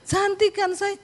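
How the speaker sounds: high-pitched coquettish asking; coy question. Retold or imitated. Wife to husband about her new hair, coy high question. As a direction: fish for a compliment in a high voice.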